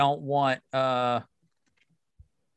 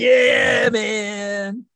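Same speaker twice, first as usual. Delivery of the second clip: stoner voice; 7 semitones higher, 4 dB louder than usual. Pretend. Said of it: "like a stoner".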